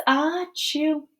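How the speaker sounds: hammed-up sneeze. Wolf sneezes.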